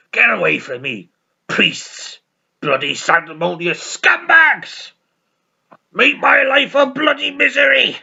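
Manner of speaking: mock drunk outburst, building; hammed-up bitter drunk; drunken rant. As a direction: hurl insults, climbing to a high loud yell. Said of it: "And he's drunk".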